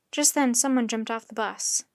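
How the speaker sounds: plain reading voice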